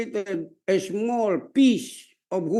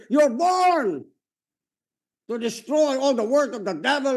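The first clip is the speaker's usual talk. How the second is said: growing shouted preaching; rising preaching shout; 2 semitones higher, 4 dB louder than usual. Real, not acted. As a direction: drive it home to the congregation.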